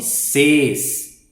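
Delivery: "ces" stretched, closed "ay" sound, firm; stronger syllable. Shown as a demonstration. Teacher showing the syllable keeps its sound, stronger. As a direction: draw out "ces" with force, vowel unchanged. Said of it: "Só que mais forte".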